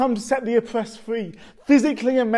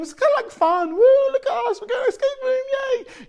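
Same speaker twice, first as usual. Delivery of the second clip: much higher, louder, gushing mock cheer; giddy mock excitement; 6 dB louder than usual. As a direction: ham up a giddy group on a day out. Mocking the group's giddy excitement.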